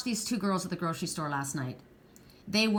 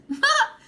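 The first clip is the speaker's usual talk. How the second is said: sudden high, loud laugh; short laugh; 9 dB louder than usual. Real, not acted. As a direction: burst out in one loud, high "ha". Amused after ex-husband married friend.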